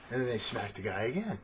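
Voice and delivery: usual speaking voice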